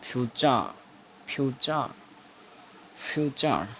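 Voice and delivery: "फ्यूचा" with no r; pron demo. Shown as a demonstration. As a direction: repeat "फ्यूचा" with an open ending. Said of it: "कोष्ठक में र".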